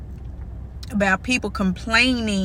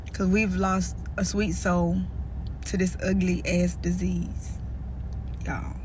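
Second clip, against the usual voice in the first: near tears; 5 dB quieter than usual. Real, not acted. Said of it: "y'all already see these tears".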